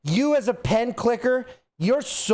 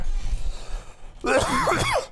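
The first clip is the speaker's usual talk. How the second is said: long bout of coughing; coughing fit. Real, not acted. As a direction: cough it out at length. Coughing fit during the monologue.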